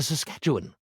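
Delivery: usual speaking voice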